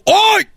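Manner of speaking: sudden high yelp from a jab; mock pained cry, very high. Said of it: "le picó. Y le hizo". (quejido agudo de dolor)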